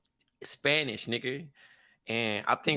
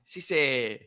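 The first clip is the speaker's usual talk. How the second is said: ordinary talk, nothing marked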